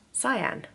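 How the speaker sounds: Cyan said like the colour, sigh-an; name Cyan said clearly, like the colour cyan; pronounced Cyan. Shown as a demonstration. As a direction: say Cyan as sigh-ann, not shahn. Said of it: "my name is pronounced".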